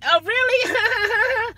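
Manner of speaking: slow, high mock surprise; sarcastic drawn-out really. Driver mocks a passenger's excuse, slow and high. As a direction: stretch it out, dripping sarcasm.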